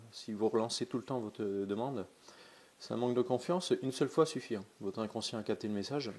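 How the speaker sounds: usual speaking voice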